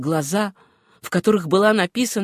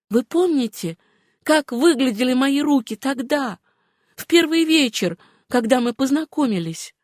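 higher-pitched, earnest woman's voice; Suzanne's voice; 6 semitones higher than usual. Acted. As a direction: explain it to him in a high, earnest voice. Героиня убеждает Джона. (высокий, серьёзный голос героини)

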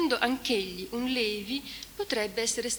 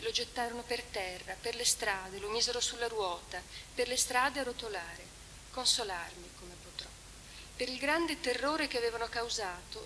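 a mournful recitation of the Trento lament; solemn reading of a lament; 5 dB quieter than usual. Acted. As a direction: read it like a mourning poem. Reads the lament translation aloud.

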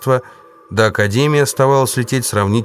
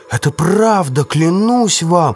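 desperate pleading; 9 semitones higher, slower than usual. Acted. Jester swears it is true.

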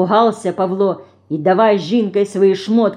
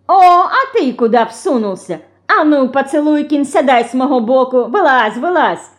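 higher, louder indignant ordering; shooing away; 6 semitones higher, 4 dB louder than usual. A narrator's reading. Федорка shoos Лёня from between the girls, bossily. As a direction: chase the boy off the seat. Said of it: "прогоняла Лёню Федорка".

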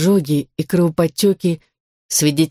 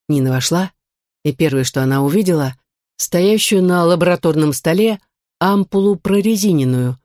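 usual speaking voice

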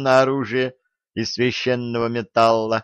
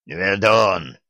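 hoarse croaking voice; 3 semitones lower than usual. Acted. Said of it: "хриплый и каркающий голос".

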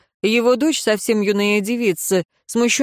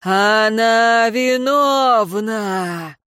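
slow, drawn-out accusing cry; long wailing verdict called out; 3 semitones higher, much slower than usual. A narrator's reading. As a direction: proclaim it, stretching every vowel. Eerie accusation, cried out loud.

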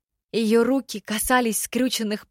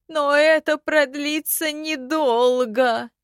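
slow, high, hollow ghost voice; skeleton voice; 6 semitones higher, much slower than usual. A narrator's reading. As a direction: drag each word like an old corpse. Skeleton warning.

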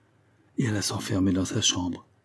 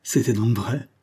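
usual speaking voice

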